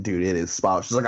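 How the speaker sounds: ordinary delivery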